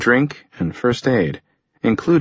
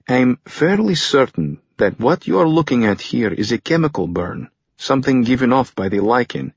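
higher-pitched, lilting doctor's voice; animated researcher laying out a hypothesis; scientist character explaining; 3 semitones higher than usual. Acted. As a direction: explain a finding with keen certainty, in character. Voices the doctor explaining his findings.

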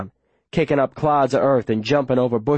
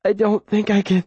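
high, breathless faltering voice; 8 semitones higher than usual. A narrator's reading. Wounded girl to the boy carrying her, breathless. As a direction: struggle to get the words out, pitched high. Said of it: "her voice breathless".

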